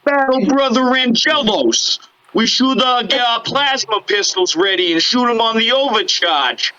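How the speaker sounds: put-on Brooklyn accent. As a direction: call to your buddy like a Brooklyn tough. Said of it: "talk like they're from, uh, Brooklyn".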